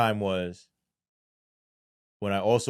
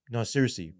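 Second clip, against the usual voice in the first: normal talking voice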